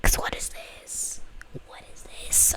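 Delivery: hushed, stunned whisper. Real, not acted. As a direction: breathe it out in awe. Stunned reaction to music video.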